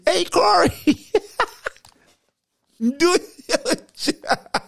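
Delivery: very high mock yell breaking into a laugh; mock coach, laughing. Host redoing the coach's yell, very high and laughing. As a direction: yell it then crack up. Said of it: "what did Coach Bennett say".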